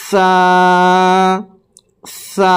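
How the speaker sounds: সা said with the tongue between the teeth, like th; lisped tha. Shown as a demonstration. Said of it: "জিহ্বাটাকে দুই দাঁতের মাঝামাঝি কিছুটা বের করে". (জিহ্বা দাঁতের মাঝে রেখে সা)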